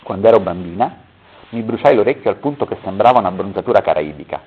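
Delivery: ordinary delivery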